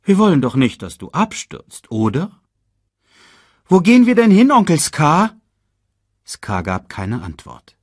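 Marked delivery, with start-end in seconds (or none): 0.0-2.4 higher, lightly mock-caring; sugary. A narrator's reading. Villain to his nephew on the rocks, sugary. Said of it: "sagte Scar süßlich". 3.7-5.4 put-on high, loud child voice; young cub. A narrator's reading. Cub asking uncle where they go, high and curious.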